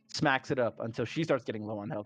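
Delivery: ordinary talk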